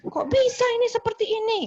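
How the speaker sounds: high, mock-angry outburst; angry complaint. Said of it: "Dia kan orang yang marah".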